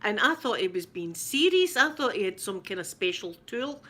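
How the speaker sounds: ordinary talk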